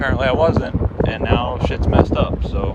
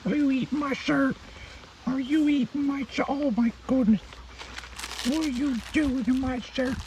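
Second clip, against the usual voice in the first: high, cooing pet voice, much higher pitched; 6 dB quieter than usual. Real, not acted. Cooing at a puppy.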